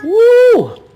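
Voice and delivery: high, loud whoop at the chili burn; spicy woo. Real, not acted. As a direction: let out a burning-mouth whoop. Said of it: "my mouth was on fire".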